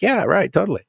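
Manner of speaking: high, dismissive yeah right; sarcastic agreement. Retold or imitated. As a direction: brush it off with mock agreement. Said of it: "It's like".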